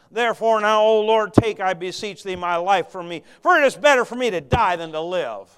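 loud, rising preached reading of the prayer; fervent scripture reading. Acted. Preacher reading Jonah's prayer to the congregation, fervent. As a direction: read it out with mounting fire.

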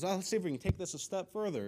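high-pitched, eager grandma voice; excited grandma. Acted. Grandma pushing her luck with Grandpa, excited. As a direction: play her as giddy and hopeful. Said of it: "Grandma was really excited".

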